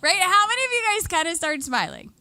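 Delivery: real laughter spilling into the question; laughing while talking. Real, not acted. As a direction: ask it still caught in the giggles. Laughing at her audience.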